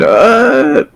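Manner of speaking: put-on high, frail old woman voice; frail old lady. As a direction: voice the old stable keeper. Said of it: "She said".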